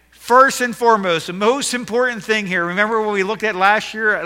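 loud, high, full-force sermon; preaching. Acted. Pastor to congregation, preaching at full force.

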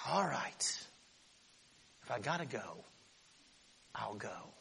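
sighed, grudging and quiet; reluctant teen sigh. Retold or imitated. Moses drags out a grudging yes to God, sighing. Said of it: "And Moses goes".

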